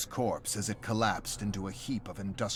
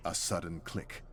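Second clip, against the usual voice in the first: plain reading voice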